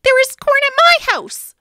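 high, loud eager offer; excited idea. Acted. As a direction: burst out with the good news. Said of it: "she said".

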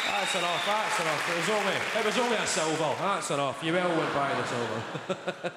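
loud, quick crowd-hushing; calm the cheering down firmly. Real, not acted. Quieting cheering audience.

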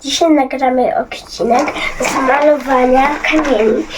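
loud, energetic vlog intro. Real, not acted. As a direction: greet the viewers loudly.

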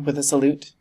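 plain reading voice

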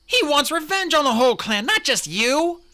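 loud, high-pitched insistence; heated, urgent outburst; urgent protest. A narrator's reading. Warrior argues with despairing leader.

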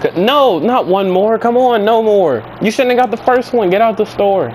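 high-pitched, insistent refusal; exasperated pleading. Acted. Driver refuses Baljeet another soda, exasperated.

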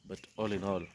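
ordinary delivery

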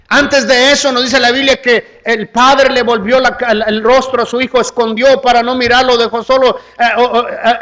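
high, loud sermon delivery; impassioned preaching. Acted. Preacher retelling the Father turning from his Son, impassioned preaching.

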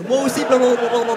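mock clingy reply. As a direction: mimic someone latching on. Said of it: "tu vas t'accrocher et répondre".

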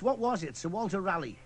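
normal talking voice

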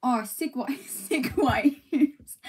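broad, hammed-up Australian vowels; wobbly put-on Australian accent; attempted Australian accent. Shown as a demonstration. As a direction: try an Australian accent, "waves" like "wives", laughing at it. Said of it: "in Australia".

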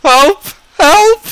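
high, loud cry for help; yelling for help. Pretend. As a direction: scream for help, half laughing. Calling for help in game.

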